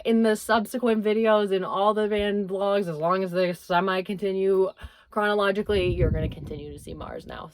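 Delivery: ordinary delivery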